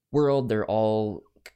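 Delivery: ordinary talk, nothing marked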